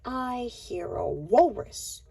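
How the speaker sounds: ordinary delivery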